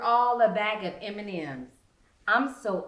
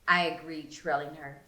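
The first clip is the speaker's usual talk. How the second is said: ordinary delivery, nothing marked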